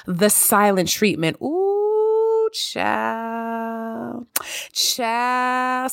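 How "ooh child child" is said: mock shock at the topic; dramatic ooh. Real, not acted. Reacting to topic.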